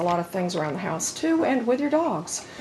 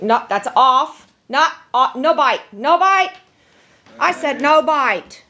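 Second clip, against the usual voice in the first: firm, louder no-nonsense command; stern command; 4 semitones higher, 4 dB louder, slower than usual. Real, not acted. Owner scolding her dog for chewing wood, stern and louder. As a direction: clip each command short and firm.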